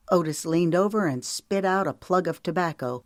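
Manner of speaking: plain reading voice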